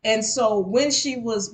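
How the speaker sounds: ordinary delivery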